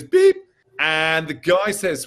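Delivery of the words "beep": high mock beep. Telling the interviewer how the TSA wand went off, squeaky beep. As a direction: imitate a security wand's beep, pitched way up. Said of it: "It goes beep".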